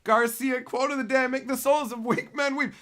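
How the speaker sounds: loud, high announcer-style read; proclaiming. Real, not acted. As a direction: belt out the quote of the day. Announcing quote of the day.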